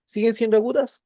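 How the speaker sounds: high, loud mock-student question. Teacher voicing a student who doubts the words are still agudas, questioning student. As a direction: pipe up as a puzzled pupil.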